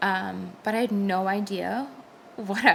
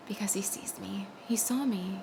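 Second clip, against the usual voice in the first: hushed, reverent whisper; 5 dB quieter than usual. Real, not acted. Testimony to viewers about God seeing her, whispered. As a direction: breathe the words softly. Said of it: "unvoiced whisper".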